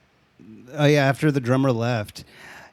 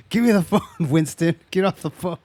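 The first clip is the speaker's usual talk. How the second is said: high-pitched mock-stern order; demanding mom; 5 semitones higher than usual. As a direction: imitate an exasperated mom on the phone. Mom sees through the phone prank.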